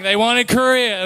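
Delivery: ordinary talk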